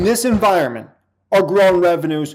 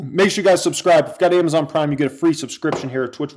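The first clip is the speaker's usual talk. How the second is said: ordinary talk, nothing marked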